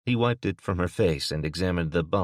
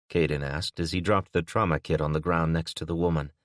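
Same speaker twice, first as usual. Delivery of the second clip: usual speaking voice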